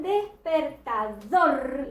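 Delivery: slow "despertador", each syllable apart, "dor" held; stress on final syllable. Shown as a demonstration. Teacher showing intensity by sound, slow "despertador" stressing "dor". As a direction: draw out the last syllable of "despertador". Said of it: "la reconozco por la intensidad, por el sonido".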